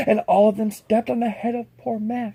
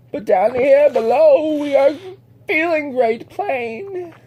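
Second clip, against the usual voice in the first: groaning plea; 5 semitones higher, 6 dB louder, slower than usual. Acted. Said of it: "groaned Mac".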